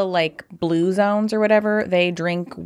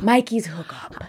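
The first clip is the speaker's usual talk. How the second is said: mock awe. Real, not acted. Gasps at the name.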